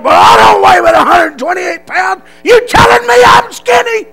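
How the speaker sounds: loud, very high mock outrage; a drill sergeant screaming at a skinny recruit; drill sergeant yelling. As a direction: scream it in his face.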